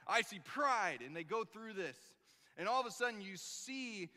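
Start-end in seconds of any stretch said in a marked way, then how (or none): 0.1-1.0 louder, slower, emphatic PRIDE; insisting correction. Retold or imitated. Yul corrects Junior's weak attempt, forceful. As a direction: drive home the word pride. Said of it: "No, no, no, no".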